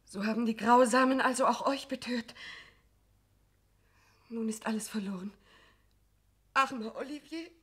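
high, breaking voice sinking to a whisper; despairing young woman. Acted. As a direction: lose all hope mid-sentence. Madelon despairs.

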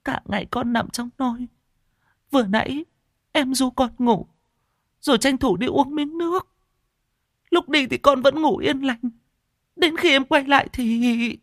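high, tearful telling; sniffling. A narrator's reading. Wife sniffles while telling her husband how it happened. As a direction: sniffle through the story of what happened. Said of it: "Vợ cậu sụt sịt".

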